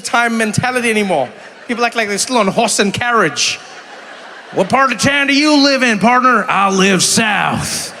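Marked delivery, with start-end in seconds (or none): 4.5-6.5 high, loud hammed-up cowboy. Cowboy impression, horse and carriage. 6.5-7.9 very slow hammed-up cowboy drawl; slow mock cowboy drawl. Cowboy answer.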